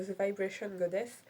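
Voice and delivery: the name said in a self-conscious English accent. Shown as a demonstration. Vlogger saying the influencer's name in an English accent. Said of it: "Oui, mon accent anglais, on ne critique pas".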